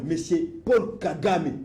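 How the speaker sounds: ordinary delivery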